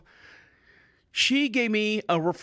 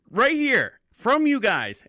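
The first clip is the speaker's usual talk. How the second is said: louder, higher insisting; louder indignant protest at the desk; insistent; 7 semitones higher, 5 dB louder, much slower than usual. Retold or imitated. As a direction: jab it, indignant. Insisting at the counter.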